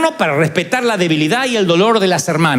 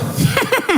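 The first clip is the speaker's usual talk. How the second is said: high laugh. Real, not acted. Laughing at aunt's answer.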